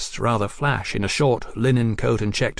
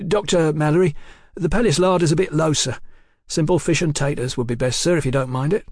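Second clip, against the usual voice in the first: higher-pitched, harried waiter's voice; apologetic waiter; 6 semitones higher, faster than usual. Acted. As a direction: break bad news deferentially to a customer.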